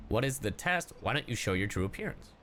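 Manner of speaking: ordinary delivery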